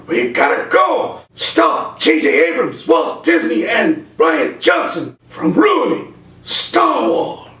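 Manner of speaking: high, slow and grave; dramatic Doc Brown. Acted. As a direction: drag it out like a doomsday warning. Doc explains mission in skit.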